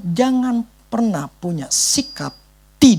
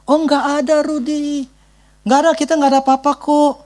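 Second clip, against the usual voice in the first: high breezy brush-off, a bit fast; dismissive pastor; 8 semitones higher than usual. Retold or imitated. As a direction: wave him off with a light high voice. (pendeta menepis dengan suara tinggi ringan)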